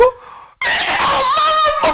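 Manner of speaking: put-on high, drawn-out child's wail of "엄마"; child crying out. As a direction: call for mom like a sobbing child. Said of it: "소리를 지릅니다". (아이가 엄마를 부르며 높고 길게 소리치다)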